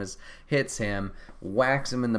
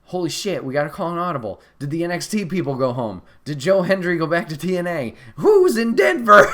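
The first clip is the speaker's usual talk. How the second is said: rising louder and higher into a frantic, laughing last question; mock panic building; 7 semitones higher, 10 dB louder than usual. Fans freaking out over an empty match spot, building to a frantic shout. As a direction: spiral up into fan hysteria. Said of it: "We're like".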